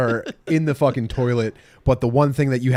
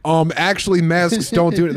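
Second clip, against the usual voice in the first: pitched-up pedantic correction; higher, officious mock objection; mock know-it-all; 5 semitones higher, 3 dB louder than usual. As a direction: imitate a smug "well, actually" type. Imitating a know-it-all in a store.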